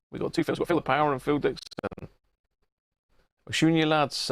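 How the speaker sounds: usual speaking voice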